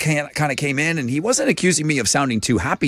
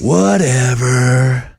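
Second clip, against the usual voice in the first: very slow, louder, dragged-out sleepy monster; Snuffleupagus voice; 6 dB louder, much slower than usual. Acted. Host tries to remember Snuffleupagus for the co-hosts. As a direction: stretch the word out like a big lazy creature. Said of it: "that's how Snuffleupagus talks".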